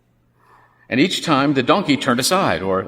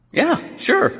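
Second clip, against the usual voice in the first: insincere agreement. Acted. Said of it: "apparently he didn't mean it".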